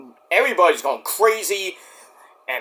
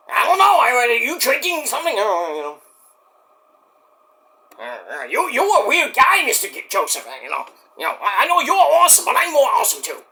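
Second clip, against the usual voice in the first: rambling high-pitched mock Mexican accent; Mike; 5 semitones higher than usual. Mike teasing the host, put-on Mexican accent. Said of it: "why did I give you a Mexican accent, Mike".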